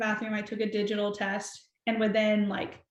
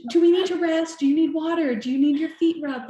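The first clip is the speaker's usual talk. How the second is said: imitating her husband's fussing, higher and louder; protective dad mode; 7 semitones higher, 6 dB louder than usual. Husband fretting over his pregnant wife on the hike, mock protective, higher and louder. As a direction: ham up an overprotective husband, high and loud. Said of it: "dad mode, protective of".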